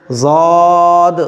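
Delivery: "ज़ाद" heavy, with a long held vowel; pronunciation demo. Shown as a demonstration. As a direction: stretch "ज़ाद" out on a level tone. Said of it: "side के दांतों से लगेगा". ("ज़ाद" को खींचकर मोटा पढ़ें)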